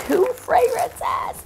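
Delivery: high, slow squeal of delight. Real, not acted. Opening the box, squealing with delight. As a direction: stretch it out in glee. Finds more gifts.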